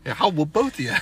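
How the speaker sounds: mock threat. Dad threatening son and grandson, mock threat. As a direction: answer the dare with a threat.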